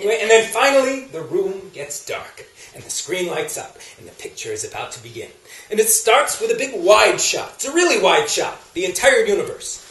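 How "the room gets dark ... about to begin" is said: low, soft, slowing as lights dim; hushed anticipation. Acted. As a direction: drop to a hush before the picture starts. Hushed cinema as film begins.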